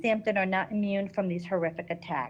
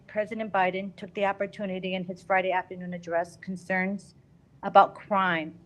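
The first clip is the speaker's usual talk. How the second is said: normal talking voice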